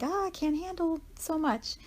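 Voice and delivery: high-pitched playful overwhelm; hammed-up, high 'too much' voice; mock overwhelm. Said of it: "If you feel an upper limit".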